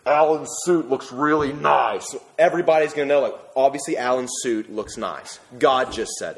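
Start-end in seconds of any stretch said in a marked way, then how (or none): 0.1-2.1 slow, put-on booming voice; mock thunder from the ground; earth speaking. Joking about Alan's suit, mock booming earth voice. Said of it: "the earth shook and said".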